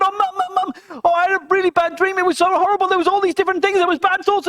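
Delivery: high, loud and fast, tumbling out; frantic upset girl. Acted. Mary Ann rushing to tell mum her bad dream, high and frantic. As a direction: rush it out like a scared child.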